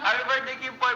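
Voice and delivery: high, loud birthday shout-out; loud greeting. Real, not acted. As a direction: call out the greeting brightly.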